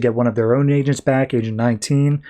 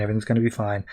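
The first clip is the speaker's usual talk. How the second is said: soothing, trailing off much softer; soothing, fading away; 3 semitones lower, 7 dB quieter, much faster than usual. Retold or imitated. Dick hushing the baby, fading to a soft hush. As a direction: let it fade out to a near hush.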